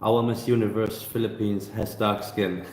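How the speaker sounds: ordinary talk, nothing marked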